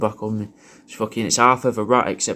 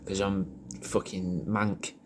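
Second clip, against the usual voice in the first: ordinary delivery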